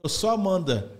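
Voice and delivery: ordinary delivery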